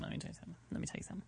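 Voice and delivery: unvoiced breathy whisper. Shown as a demonstration. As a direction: whisper it like a secret. Repeats phrase, then whispers it.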